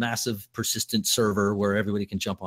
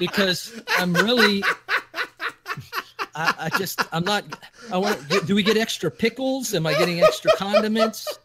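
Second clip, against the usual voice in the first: high, laughing mock complaint; laughing customer; 9 semitones higher, 3 dB louder than usual. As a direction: giggle through a whiny complaint. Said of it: "you say".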